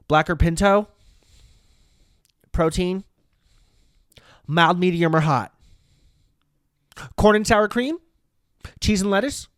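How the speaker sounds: loud, high, rapid-fire server rattling off options; rushed worker voice. Retold or imitated. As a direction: bark the choices like a hurried Chipotle worker. Mimicking rushed Chipotle worker.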